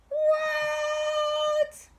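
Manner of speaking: very high, long "What?"; astonished shout. Acted. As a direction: gape at the flying box. Swimmers shocked.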